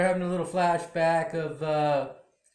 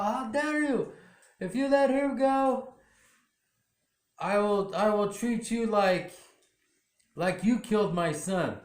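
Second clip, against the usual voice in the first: outraged congressman; 6 semitones higher than usual. Retold or imitated. Grieving congressman threatens officer.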